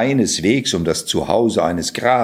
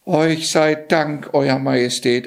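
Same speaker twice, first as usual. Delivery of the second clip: shivering thanks; 7 semitones higher than usual. A narrator's reading. Johann thanking the king, shivering with cold. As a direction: thank as if still chilled. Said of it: "immer noch zitternd und frierend".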